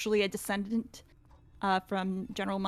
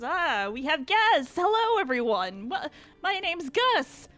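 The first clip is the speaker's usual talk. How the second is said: a welcoming, slightly fussy host; higher-pitched eager host greeting guests; host Gus; 9 semitones higher, 4 dB louder than usual. Acted.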